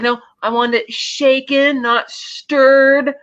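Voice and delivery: exaggerated patronizing order, slow and high; talking down slowly. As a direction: spell it out as if the listener doesn't understand. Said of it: "doing it as if he doesn't understand".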